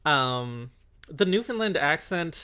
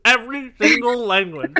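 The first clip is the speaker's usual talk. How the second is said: mock dramatic; 6 semitones higher, 9 dB louder than usual. Real, not acted. Mock grand emphasis with laugh.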